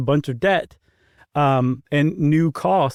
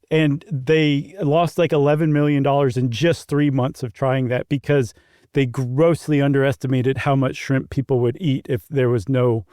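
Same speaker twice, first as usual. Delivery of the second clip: ordinary talk, nothing marked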